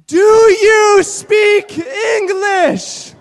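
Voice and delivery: slow, loud, drawn-out shouted question. Retold or imitated. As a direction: bellow each word slowly at the clerk. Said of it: "what does Mark Clark say to him the second time".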